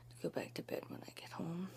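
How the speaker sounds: whispering so nobody wakes; hushed early morning whisper; quiet whisper. Real, not acted. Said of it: "I'm being quiet 'cause my mom is still sleeping".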